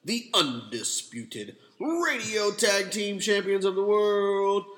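booming circus ringmaster intro. Pretend. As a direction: announce it like a big-top ringmaster. Radio show intro, announcer.

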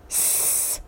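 soft, drawn-out creature hiss; snake-like hiss. A narrator's reading. Gollum reacts to Bilbo's question, long soft hiss. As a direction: let out a long quiet sibilant. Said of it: "hissed Gollum".

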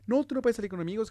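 mock strict parent. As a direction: snap the rule like a controlling parent. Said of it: "de que".

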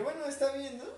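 laughing through a shrugging okay. Retold or imitated. Man turned down at a party, laughing it off. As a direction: brush off the rejection with a laugh. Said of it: "Y le dije, le dije".

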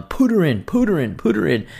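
high, insistent mock nagging of a wife; hammered-up wife urging him on; urgent repeated command. Retold wife nagging him at the car, high and insistent. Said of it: "Beck's basically going".

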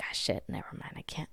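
hushed retreat of a guilty pet; sheepish cat. Retold or imitated. Cat giving up after being hissed at, sheepish and very soft. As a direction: back down under your breath as the cat. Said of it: "He's like".

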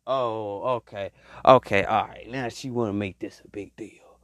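low, quiet, acted-out backing off; muted resignation. Man reacting to woman making a fuss, low resigned muttering. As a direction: mutter it like a man sizing up trouble.

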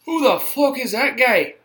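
loud mock Irish fighter accent; Conor McGregor impression. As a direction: yell it like a brash Irish fighter. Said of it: "Conor McGregor says that".

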